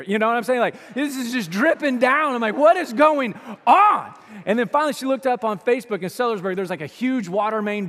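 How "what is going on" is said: slow, loud, higher exasperation; stuck in a dry shower, loud and slow exasperation; exasperated. Retold or imitated. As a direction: draw it out in disbelief. Frustrated shower complaint.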